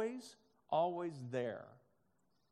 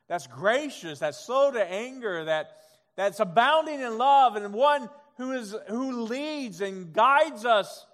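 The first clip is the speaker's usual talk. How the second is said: loud, higher, rising sermon climax; preaching at full force; 4 semitones higher, 8 dB louder than usual. Real, not acted. Pastor preaching to the congregation, loud and rising. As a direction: preach it out with building fire.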